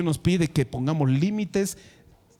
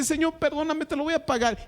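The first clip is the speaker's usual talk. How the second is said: higher, begging servant's voice; pleading servant; 9 semitones higher than usual. Retold or imitated. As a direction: plead for mercy in a raised voice. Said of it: "el otro le ruega".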